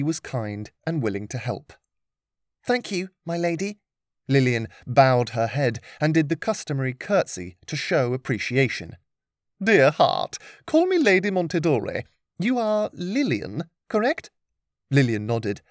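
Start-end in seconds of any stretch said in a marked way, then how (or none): 2.6-3.8 put-on young girl voice. Lillian thanks politely. 9.6-14.3 higher, gracious noble lady. Acted. Lady Montedore voiced.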